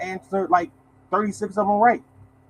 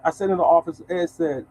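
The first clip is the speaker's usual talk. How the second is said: ordinary talk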